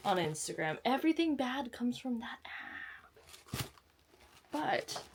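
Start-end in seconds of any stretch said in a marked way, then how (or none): 0.9-3.7 whispered, slowed aside. Pretend. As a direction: hush it like a confession. Aside whispered about Instagram.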